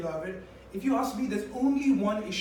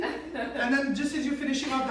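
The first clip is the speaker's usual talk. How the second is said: ordinary talk, nothing marked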